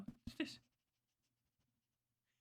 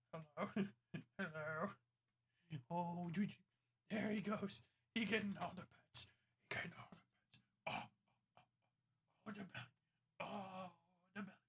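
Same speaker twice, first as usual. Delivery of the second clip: talking to a pet; 8 dB quieter than usual. Real, not acted. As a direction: coo quietly at the pet. Cooing over pet on cam.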